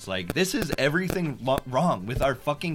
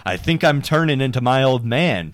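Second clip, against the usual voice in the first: loud, hammed-up recital of the lyrics; mocking lyric reading; 2 semitones higher, 10 dB louder than usual. Shown as a demonstration. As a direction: read it out like a bad patriotic anthem. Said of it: "I'll read them really fast".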